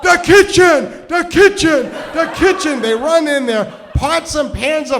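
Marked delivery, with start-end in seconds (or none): 0.0-2.8 high, loud repeated shout; acted boss yelling to the kitchen; top-of-lungs yell. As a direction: holler urgent orders across to the whole family. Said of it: "he yells in Greek at, like, at the top of his lung".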